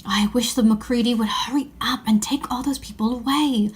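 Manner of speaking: hushed unvoiced whisper. Child hiding in a wardrobe, whispering to the others. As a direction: whisper so the grown-ups won't hear.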